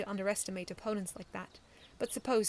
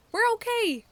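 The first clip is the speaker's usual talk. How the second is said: high, loud reassurance; relieved shout; 12 dB louder than usual. A narrator's reading. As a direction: call out that all is well.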